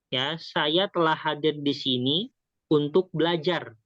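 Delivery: usual speaking voice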